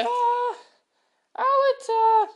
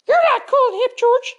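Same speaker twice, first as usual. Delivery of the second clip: ordinary talk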